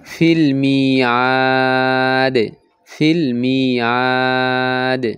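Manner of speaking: very slow chanted ফিল মিআদ with a strong দ echo; strong stop qalqala. Shown as a demonstration. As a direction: stretch ফিল মিআদ, hit the final দ hard. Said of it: "কলকলার উচ্চারণ শক্তিশালী হবে".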